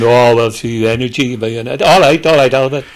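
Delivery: dismissive valleys bloke. Retold or imitated. Mock valleys pub reply to Einstein.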